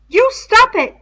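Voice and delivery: high, loud shrill protest. A narrator's reading. Piggy cutting off Ralph's talk of murder, shrill. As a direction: shriek it at him to make him stop. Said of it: "said Piggy shrilly".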